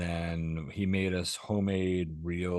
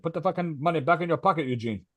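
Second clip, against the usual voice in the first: mock stern neighbor; 7 semitones higher than usual. Jose voiced settling the argument.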